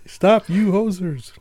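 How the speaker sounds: slow, high mock Canadian accent. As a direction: tease like a Canadian, drawn out. Canadian jab at listeners.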